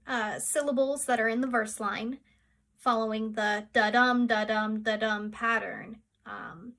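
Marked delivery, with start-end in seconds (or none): 3.7-5.3 "da-dum" with the stress on each "dum"; iambic beat. Shown as a demonstration. Teacher showing unstressed and stressed syllables, "da-dum" stressed on "dum". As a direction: chant "da-dum" evenly, weak then strong. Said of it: "which are the stressed and unstressed syllables".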